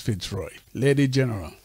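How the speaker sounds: normal talking voice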